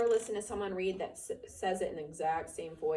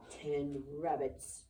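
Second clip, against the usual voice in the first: scornful; 4 semitones lower, 4 dB quieter, much slower than usual. A narrator's reading. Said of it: "it said scornfully".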